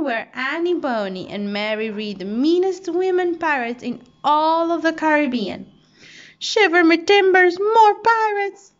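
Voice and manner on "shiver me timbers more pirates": loud, higher, slowing terrified shriek; panicked scream. A narrator's reading. Monkey screams on seeing the women pirates, loud panic. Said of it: "screamed Chee-Chee".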